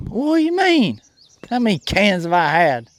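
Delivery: put-on affronted country boy in a Southern accent.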